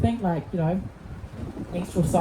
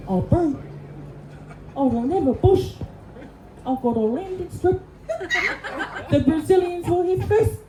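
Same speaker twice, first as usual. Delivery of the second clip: lightly raised New Zealand accent, hammed-up; Kiwi great-grandmother; 9 semitones higher than usual. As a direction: play the great-grandmother as a cheeky Kiwi, a touch higher. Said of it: "She would have said".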